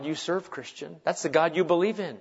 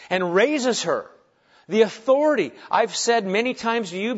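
raised, impassioned sermon voice; emphatic preaching; 5 semitones higher, 7 dB louder than usual. Acted. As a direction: proclaim the miracle to a congregation. Preaches on the miracle.

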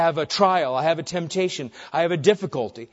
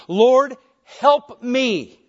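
raised, louder cry for help; pleading cry; 6 semitones higher, 7 dB louder, much slower than usual. A narrator's reading. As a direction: plead upward with force, as the kneeling woman. Voices the kneeling woman's plea.

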